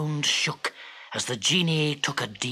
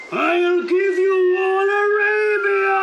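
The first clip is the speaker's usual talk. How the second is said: very high, louder shriek; 13 semitones higher, 6 dB louder than usual. Acted. Genie shrieking as the bottle flies through the air. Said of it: "shrieked the genie".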